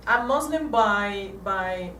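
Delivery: ordinary delivery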